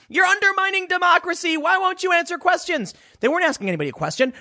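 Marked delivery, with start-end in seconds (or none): 0.1-2.9 much higher, mimicking shouting reporters; mock reporter yelling. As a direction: parody the frantic press. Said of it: "They were just randomly yelling things".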